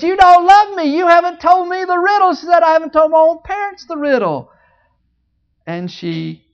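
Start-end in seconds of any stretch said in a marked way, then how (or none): 0.0-2.3 whiny wife. Acted. Wife crying, whining at Samson. 2.6-4.5 whiny wife. Acted. Wife crying, whining at Samson.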